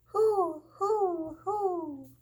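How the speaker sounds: high, loud mock hooting; imitated owl hoot. Little Mouse imitates the ghostly calling to Big Mouse, high loud hoots. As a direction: copy the owl's hoot. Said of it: "He keeps calling".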